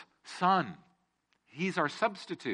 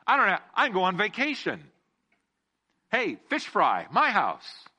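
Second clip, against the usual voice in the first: higher, louder, breezy mock thanks; upbeat mock cheer about a fish fry; casual excited Peter; 4 semitones higher, 3 dB louder than usual. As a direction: play him as a careless fisherman cashing in. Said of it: "he doesn't just go".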